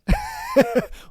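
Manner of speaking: a shrill high burst of laughter; high shrieking laugh. Real, not acted. Streamer advising a viewer, shrill laugh. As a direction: let out a high squeal.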